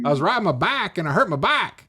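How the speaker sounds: high-pitched mock East Tennessee twang; Eastern Tennessee accent. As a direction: caricature an Eastern Tennessee man. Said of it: "bike were both pronounced back".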